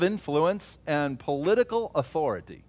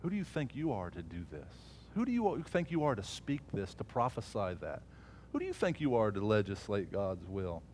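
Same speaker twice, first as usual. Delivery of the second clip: soft, belittling inner voice; quiet mocking voice; 3 semitones lower, 9 dB quieter than usual. The enemy taunting a believer in his head, softly mocking. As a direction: hush the doubts like a taunting thought. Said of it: "mock it".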